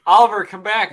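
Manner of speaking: calling loudly after the cat. Real, not acted. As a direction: call the cat back, loud.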